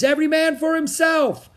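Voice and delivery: high, loud cry to the whole crew; full, urgent high yell; captain's shout. A narrator's reading. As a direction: bellow an emergency order. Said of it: "the captain shouted".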